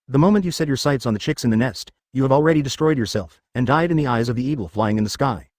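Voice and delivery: usual speaking voice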